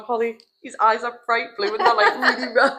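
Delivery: high pitched, breaking into laughter; laughing while talking. Real, not acted. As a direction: giggle through the words. Laughing while describing egg.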